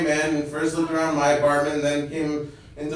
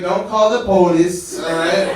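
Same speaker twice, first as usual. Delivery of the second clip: louder, slow plea; pleading; 3 semitones higher, 6 dB louder than usual. Retold or imitated. Tenant pleading with the neighbor, loud. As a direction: beg her not to call. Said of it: "I'm like".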